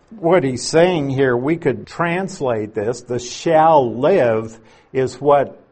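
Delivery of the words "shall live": "shall live" pushed a bit louder; stress on shall live. Shown as a demonstration. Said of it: "is the emphasis in this quotation".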